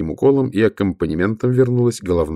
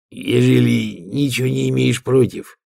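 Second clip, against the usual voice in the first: put-on young troll voice; 5 semitones higher than usual. Young troll messenger speaks.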